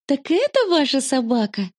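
mildly surprised exclamation; exclaiming. A narrator's reading. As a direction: exclaim it as a question, lightly. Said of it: "воскликнула мама".

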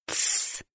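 sharp whispered shush; urgent shushing hiss. A narrator's reading. Alicia hushes Kristina in a frightened whispered shush. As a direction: hush her friend in a panic. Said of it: "прошептала".

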